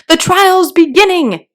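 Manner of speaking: high loud call from afar; distant cry. Acted. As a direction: shout the news from far off. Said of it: "was heard in the distance".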